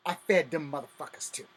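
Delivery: normal talking voice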